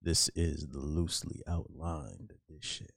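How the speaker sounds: low, slow late-night radio host; sensual radio voice. Acted. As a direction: croon it low like a smooth DJ. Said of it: "this man sounds sensual as fuck".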